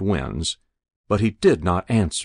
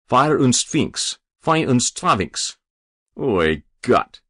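put-on slow, higher fretting old man; flustered old man; 4 semitones higher, slower than usual. Old Yakov Blotnick fretting at the doorknob, slow flustered cry. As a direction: wring your hands as you say it.

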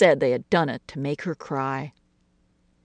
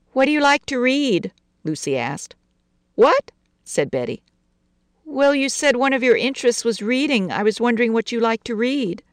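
put-on higher, earnest child asking; little girl voice; 8 semitones higher than usual. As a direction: ask shyly like a small girl. Lucy questions Betty.

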